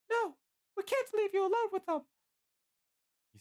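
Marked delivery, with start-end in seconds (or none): 0.1-2.1 very high falsetto protest; protesting Licia. A narrator's reading. Licia refuses to abandon Bill, protesting falsetto.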